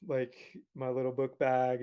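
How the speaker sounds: usual speaking voice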